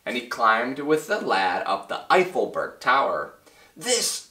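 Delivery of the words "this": very high ringing cry; cried out. Acted. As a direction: proclaim it high and dramatic. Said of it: "cried the mayor".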